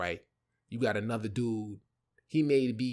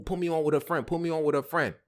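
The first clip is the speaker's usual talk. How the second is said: higher-pitched, imitating a pestering friend; retold eager pleading; 5 semitones higher than usual. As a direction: beg a favor in a raised voice. Retold friend begging for introduction.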